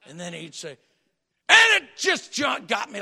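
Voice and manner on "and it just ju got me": sudden shout. Retold or imitated. Preacher mimicking Vineyard's scare line, shouting. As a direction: burst out much higher and louder. Story's jump-scare punchline.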